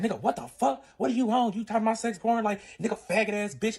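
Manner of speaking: high, heated retold quarrel; angry outburst. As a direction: fire off outraged questions and insults.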